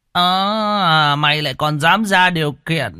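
high outraged exclamation; indignant. Acted. Chief outraged at the peasant's condition, indignant. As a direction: bristle at the cheek of it.

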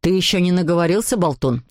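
low, cross dad voice; father's mild shout. A narrator's reading. Papa scolds the supposed young caller, low irritated voice. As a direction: snub the caller as annoyed papa. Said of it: "закричал папа".

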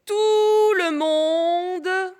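very slow, drawn-out dictation; dictating. Shown as a demonstration. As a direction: stretch "Tout le monde" very slowly. Dictating a phrase slowly.

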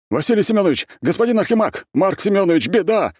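loud, very high frantic shout; barked alarm. A narrator's reading. Guards call to their chiefs for help, alarmed shouting. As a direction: holler the bad news at full pitch. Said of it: "гаркнули они".

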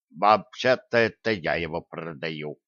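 low, bubbling amoeba voice; gurgling tentacle alien. A narrator's reading. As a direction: gurgle it out like a blob. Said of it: "пробулькала амеба".

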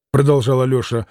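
plain reading voice

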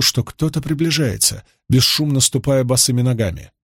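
ordinary delivery, nothing marked

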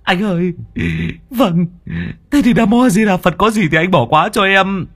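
high, pleading apology; a high-voiced servant begging forgiveness; high apologetic servant. Servant begging the older brother's pardon, high and pleading.

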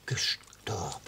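normal talking voice

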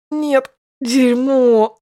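slow, high, loud pained growl. Acted. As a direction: growl it out while writhing in pain. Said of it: "прорычал я". (рычание от боли)

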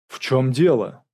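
loud, surprised question. A narrator's reading. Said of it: "с удивлением спросил дежурный офицер". (с удивлением, громко)

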